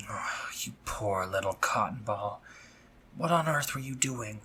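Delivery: soft whispered pity; tender whisper. Acted. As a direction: whisper it gently like comforting a child. Tender pity for hurt friend.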